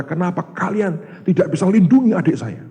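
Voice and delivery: high, loud, furious demand; angry shout. Retold or imitated. Older brother yelling at his little brother's friends, furious and loud. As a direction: rage at the friends for failing him. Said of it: "Dia marah".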